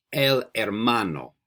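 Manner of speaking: "El hermano" slowly, with the h silent; model pronunciation. Shown as a demonstration. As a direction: say "El hermano" drawn out for learners to repeat, no h sound. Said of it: "I'm gonna say it three times".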